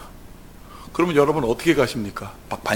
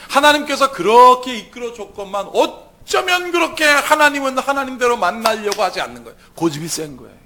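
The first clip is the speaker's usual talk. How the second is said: ordinary talk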